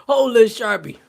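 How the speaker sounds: loud, high mock yell. Barber ordering Boozer to hold the Sharpie, loud. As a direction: bark it like the angry barber. Repeats barber line louder.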